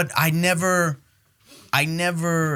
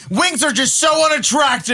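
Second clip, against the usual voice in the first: louder, higher, near shouting; excited rant; 9 semitones higher, 5 dB louder than usual. Real, not acted. To the hosts, ranting loudly about eating wings. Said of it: "He's out of control".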